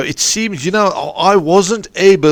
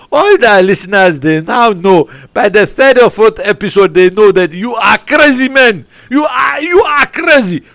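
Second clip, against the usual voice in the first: louder and higher, teasing and building to a shout on "crazy"; 5 semitones higher, 4 dB louder than usual. Real, not acted. Host teasing guest to the listeners, loud building shout. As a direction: rib him loudly, climbing higher to a shout.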